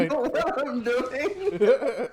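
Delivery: high, slow, laughing put-on Canadian accent; thicker Canadian accent. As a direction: lay the Canadian accent on thick while cracking up. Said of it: "cast in a thicker accent".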